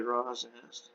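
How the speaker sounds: ordinary delivery, nothing marked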